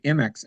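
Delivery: M X as "em-ex", starting on em; correct pronunciation. Retold or imitated. Guest repeating what he overheard, M X pronounced "em-ex". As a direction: say M X without any eye sound. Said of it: "I kept hearing them say".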